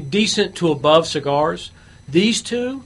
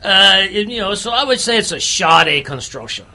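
hammed-up Louisiana bayou accent; put-on Cajun accent. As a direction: play a Cajun gator hunter, accent laid on thick. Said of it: "I still don't know whether it's Cajun".

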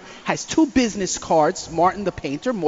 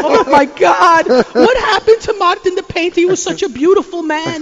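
hammed-up crying neighbor; mock bawling; 9 semitones higher, 6 dB louder than usual. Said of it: "she's bawling, crying her eyes out".

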